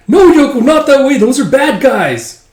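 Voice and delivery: high, loud imitated yell to stop Yoko; alarmed call. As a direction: call out after her, mock panic. Calling a character back in alarm.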